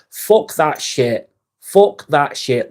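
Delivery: each word dragged out slow and hard; slow emphatic rant. Real, not acted. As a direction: spell it out slowly, you're done with it. Venting against people pleasing.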